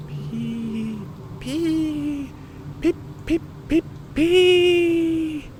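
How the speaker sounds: very high piped toots, long and short; thin whistle peeps, swelling on the last; engine whistle. Acted. Percy gliding up to the shed, imitated whistle.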